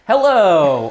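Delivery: high, loud bright hello; cheery own intro. Retold or imitated. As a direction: bounce in like a YouTuber greeting. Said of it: "and I was just like".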